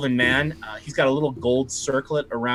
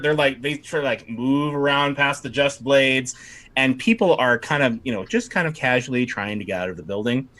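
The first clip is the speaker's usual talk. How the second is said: ordinary talk, nothing marked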